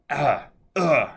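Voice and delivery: a hint of a shocked learner's groaning; imitated pained grunts. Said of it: "the learner starts to yell".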